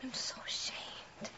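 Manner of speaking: very soft, slow, voiceless confession; ashamed whisper. Acted. Confessing to her sister, ashamed whisper. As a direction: let it out barely above a breath.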